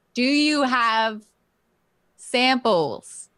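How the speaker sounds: loud, slow, over-enunciated for a foreigner; loud, slow talking-down to a shopkeeper who doesn't get you. Pretend. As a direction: speak slowly and loudly as if he can't understand. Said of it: "I'm talking really loudly as though he can't understand me".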